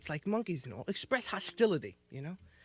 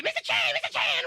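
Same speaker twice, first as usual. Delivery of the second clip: much higher, frantic scared cries; panicked calling; 5 dB louder than usual. Retold or imitated. Scared chauffeur calls his boss, high panicked cries. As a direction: call for help in a panic. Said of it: "he'd spit".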